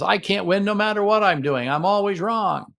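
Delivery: mild mock defensive whine. Shown as a demonstration. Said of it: "See the defensiveness?".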